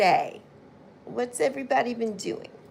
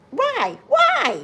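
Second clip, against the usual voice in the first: mock exasperated whine; 13 semitones higher, 4 dB louder than usual. Real, not acted. Mock complaint about school starting.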